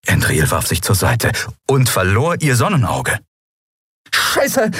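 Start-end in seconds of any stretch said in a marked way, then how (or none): 4.1-4.7 high, gasped curse. A narrator's reading. Downed woman cursing as she grabs for her weapon, gasping. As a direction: gasp it out in a higher voice. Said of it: "keuchte sie".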